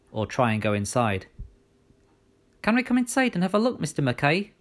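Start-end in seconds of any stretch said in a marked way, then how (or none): 2.6-4.5 put-on girl asking politely. A narrator's reading. Girl voiced by narrator.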